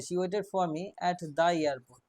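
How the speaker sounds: the said slowly and firmly, with a falling tune; stress on the. Shown as a demonstration. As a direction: slowly dictate the sentence and lean on the. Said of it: "নির্দিষ্ট করে বলেই দিচ্ছে".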